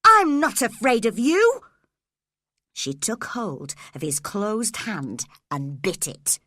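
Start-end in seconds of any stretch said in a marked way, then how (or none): 0.0-1.6 higher and much louder; furious girl shouting; defiant. A narrator's reading. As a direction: hurl defiance at him. Cathy defying Heathcliff.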